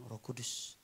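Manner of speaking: soft, low invocation; hushed, low-pitched plea; gentle prayer. Shown as a demonstration. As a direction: say it quietly to God. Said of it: "pelan-pelan bilang".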